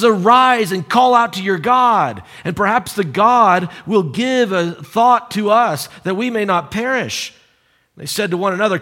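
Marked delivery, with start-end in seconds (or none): 0.0-7.3 raised, higher voice of urgent command; yelling over a storm. Acted. Captain urging a sleeper to pray, yelling. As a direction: make yourself heard above the storm. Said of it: "imagine him yelling over the raging storm".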